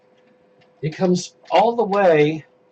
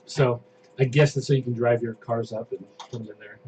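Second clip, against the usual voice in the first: ordinary talk, nothing marked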